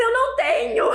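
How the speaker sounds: very high, hammed-up despair; mock tearful wail. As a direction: wail it as if bawling. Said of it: "ó, chorando". (choramingando, bem agudo, fingido)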